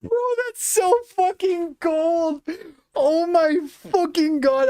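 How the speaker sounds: high, giddy delight; gleeful. Real, not acted. Streamer gloating to Rob, giddy triumphant crow. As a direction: crow over the recording.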